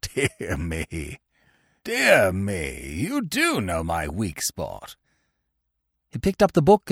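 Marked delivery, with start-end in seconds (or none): 0.0-5.0 put-on low, slow old uncle, quietly tickled; chuckling old man. A narrator's reading. Old uncle flattered by his favourite book, low and tickled. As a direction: savour it deep and slowly. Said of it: "gave a sort of gurgling chuckle".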